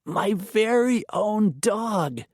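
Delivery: put-on high, slow delighted child; crowing little girl. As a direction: crow it out with pure joy. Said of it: "she crowed".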